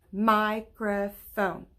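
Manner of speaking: Microphone said slowly in three beats, MI-CRO-PHONE; clapped syllables. Shown as a demonstration. Teacher to kids, slowly clapping out the syllables. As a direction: stretch Microphone out, one clap per part. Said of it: "I hear three".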